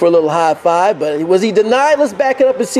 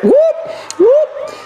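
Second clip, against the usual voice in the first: whoop call. Acted. Announcer calling out the whoop play, high whoops. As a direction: whoop it up like a fan in the stands.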